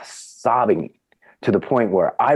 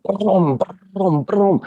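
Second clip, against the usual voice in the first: much higher "boom" crash noises; imitated crashes; 13 semitones higher, much slower than usual. Retelling the cane wiping out the table, imitated boom. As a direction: make the cane's smash sound.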